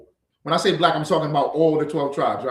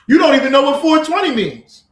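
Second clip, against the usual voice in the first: raised, heated voice; louder, higher scolding; loud rant; 11 semitones higher, 8 dB louder than usual. Real, not acted. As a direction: lay into them, fired up. Angry rant about weed culture.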